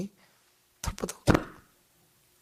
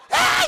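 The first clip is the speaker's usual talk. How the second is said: laughing mock scream; 8 dB louder than usual. Mother screams.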